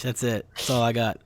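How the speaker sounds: ordinary delivery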